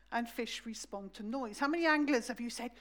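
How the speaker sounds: normal talking voice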